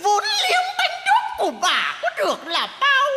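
imitating a cursing market woman. Clown imitates the cake-seller cursing a debtor, high screeching scold. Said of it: "Bà xoắn váy quay cổng thế này".

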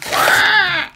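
sudden high startled squawk; a shrill startled screech, like a pterodactyl. Real, not acted. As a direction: yelp of surprise at the pack. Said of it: "Apparently I'm a pterodactyl".

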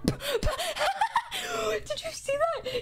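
high squealing laugh through the words; cracking up at the screen. Real, not acted. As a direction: shriek it out mid-laugh. Laughing at a game moment.

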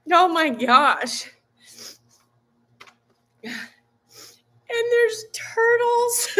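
high, slow, on the edge of crying; choked up with real gratitude; thanking friends for a birthday card, tearful. Real, not acted. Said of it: "You're gonna make me cry".

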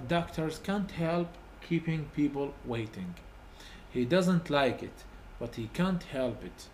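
very slow, word by word, so pupils can write; slow dictation. Shown as a demonstration. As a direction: spell the example out slowly for the class. Teacher reads example for dictation. (قراءة بطيئة جداً للإملاء)

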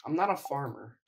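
normal talking voice

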